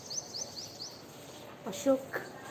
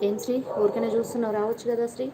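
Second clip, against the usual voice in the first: ordinary delivery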